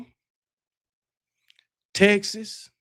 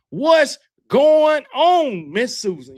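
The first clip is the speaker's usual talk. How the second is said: excited greeting; 6 semitones higher, 5 dB louder, much slower than usual. Real, not acted. Greeting a viewer in stream chat.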